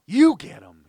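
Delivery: high, loud cry pushing the job away; loud refusal. A narrator's reading. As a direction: shout it back at her. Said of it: "Josh cried".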